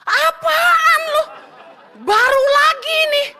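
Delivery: high, slow, laughing disbelief; laughing outrage. Real, not acted. Preacher reacting to the shameless proposal, laughing and high.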